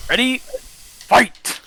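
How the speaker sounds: big arena call, ready fight; booming fighting game announcer. Acted. Host announces bracket battle.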